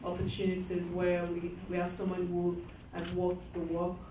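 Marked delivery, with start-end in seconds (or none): none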